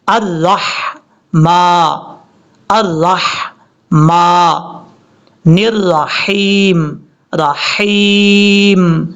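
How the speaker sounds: very slow, split 'الرحمن الرحیم'; correct recitation. Shown as a demonstration. Teacher shows how to read it, slow correct recitation. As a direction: hold each syllable of 'الرحمن الرحیم', throaty ح. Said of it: "اس طریقے سے".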